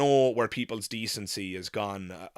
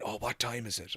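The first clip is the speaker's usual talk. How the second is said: hushed whisper; 8 dB quieter than usual. Retold or imitated. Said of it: "somebody whispered".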